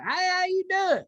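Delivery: high, slow friendly hello; drawn-out good-old-boy greeting; hammed-up country charm. Driver to the officer who pulled him over, hammed-up charm.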